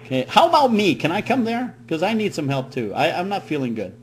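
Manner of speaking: usual speaking voice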